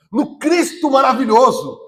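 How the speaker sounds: normal talking voice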